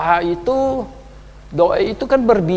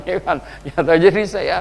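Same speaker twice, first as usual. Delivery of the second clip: ordinary delivery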